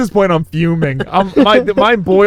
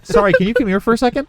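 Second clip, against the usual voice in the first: mock sweet customer; 10 semitones higher, faster than usual. Customer calling waiter, mocked.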